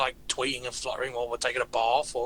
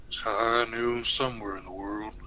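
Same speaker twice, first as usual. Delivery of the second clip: put-on American accent, pitched lower; McCree; 5 semitones lower than usual. Shown as a demonstration. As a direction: deliver it like a Southern gunslinger's catchphrase. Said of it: "I have to do McCree".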